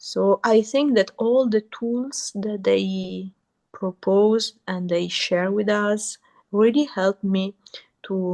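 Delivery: ordinary delivery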